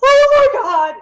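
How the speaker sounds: high squealing oh my God; a sudden delighted shriek; excited squeal. Real, not acted. Reacting to homework list.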